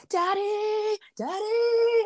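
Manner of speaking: put-on high whiny kid voice calling Daddy; child calling daddy. Child calling for daddy to tattle. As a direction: mimic a small child tugging for attention. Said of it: "he goes".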